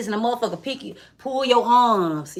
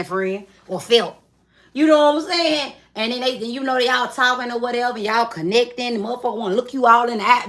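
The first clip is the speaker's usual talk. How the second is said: ordinary delivery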